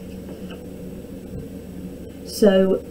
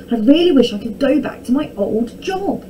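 higher, mildly wistful; sighed wish; 5 semitones higher, 4 dB louder than usual. A narrator's reading. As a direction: lament with a touch of longing. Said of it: "he sighed".

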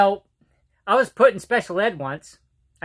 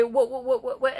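stuttering; 4 semitones higher, 5 dB quieter than usual. Retold or imitated. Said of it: "I stuttered really bad".

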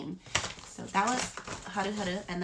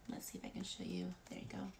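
whisper; 13 dB quieter than usual. Real, not acted. Showing product close to camera.

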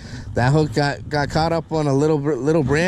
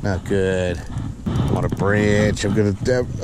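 low, deflated grumble at a snag; real dismay; 8 semitones lower, slower than usual. Real, not acted. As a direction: let the voice sink in disappointment. Line snagged on a branch.

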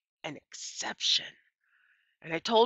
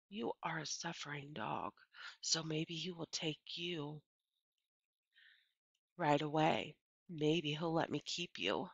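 quiet; 12 dB quieter than usual. A narrator's reading. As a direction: hush it to the dog like a secret. Opal talks softly to the dog.

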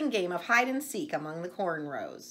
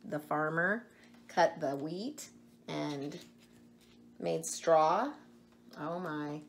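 plain reading voice

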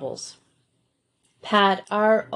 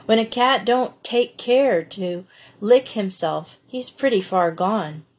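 ordinary delivery

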